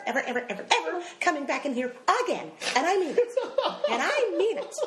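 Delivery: high-pitched, emphatic mother on the warpath; mock-furious ranting mother; indignant tirade. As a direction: lay down the law in a high, outraged voice. Acting out outraged mother's tirade.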